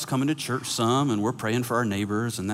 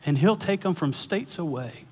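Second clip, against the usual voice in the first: earnest preaching; 5 semitones higher, slower than usual. Acted. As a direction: lean into the point and slow down. Preaching climax, slow.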